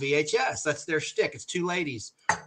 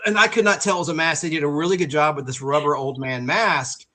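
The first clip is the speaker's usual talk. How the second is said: usual speaking voice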